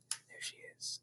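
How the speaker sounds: hushed aside to the listener; whisper. Real, not acted. Narrator whispers to listening children, pointing at Matilda. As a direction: breathe it like a secret.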